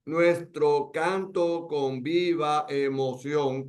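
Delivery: slow, each syllable separated; grammatical reading, syllables apart. Shown as a demonstration. Teacher splitting the verse into grammatical syllables, slow. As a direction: spell the verse out slowly by syllables.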